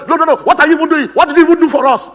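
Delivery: loud, high, accusing complaint; a wife yelling at her absent husband, high and loud; nagging wife. Acted. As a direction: scold him like a fed-up wife.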